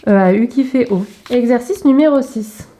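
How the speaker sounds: normal talking voice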